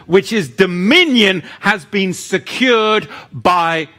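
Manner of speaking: high, ringing sermon delivery; forceful preaching. Real, not acted. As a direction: drive each key word home with conviction.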